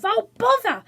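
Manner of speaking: high loud yelp; cry of pain. A narrator's reading. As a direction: yelp it as the hot egg burns. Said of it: "with a cry of".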